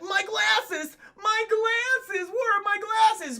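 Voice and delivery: high, whining fret; hammed-up high-pitched distress; mock panic. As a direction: wail over something lost. Acting Newman hunting lost glasses.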